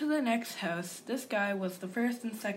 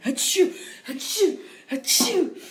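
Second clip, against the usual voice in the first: three loud sneezes; string of sneezes; 7 semitones higher, 10 dB louder, slower than usual. Acted. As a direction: sneeze and sneeze again. Sneezing fit at the door.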